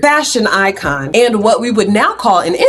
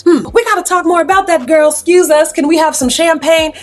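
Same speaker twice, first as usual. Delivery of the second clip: much higher, eager voice; excited businessman; 8 semitones higher than usual. Acted. Jean to Jane and the crew, eager and high. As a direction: jump on the idea and call for champagne. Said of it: "Jean says".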